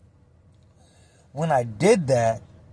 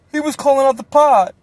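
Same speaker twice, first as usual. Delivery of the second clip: ordinary talk, nothing marked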